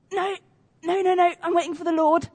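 high, loud repeated no; stubborn refusal. Retold or imitated. Man refuses the helicopter crew, high stubborn shout. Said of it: "And he goes".

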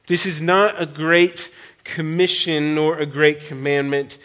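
usual speaking voice

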